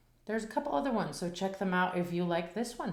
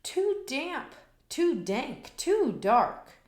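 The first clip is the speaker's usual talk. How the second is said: high squeaky little mouse voice; small mouse complaining about the damp cave; 8 semitones higher, 3 dB louder than usual. A narrator's reading. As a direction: squeak it like a tiny fussy mouse. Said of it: "Mouse squeaks".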